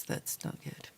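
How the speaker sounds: ordinary talk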